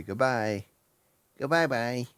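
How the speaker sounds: soft drawn-out goodbye imitation; Eddie. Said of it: "I just wanna see Eddie say".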